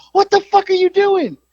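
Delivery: high-pitched, incredulous outcry; re-enacted shock, voice way up high; alarmed demand. Employee confronting a man caught outside his office, alarmed.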